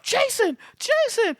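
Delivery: very high-pitched imitated scream; high hammed-up cry at normal volume; mock scream. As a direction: squeal the name like a panicked victim. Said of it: "she screams out".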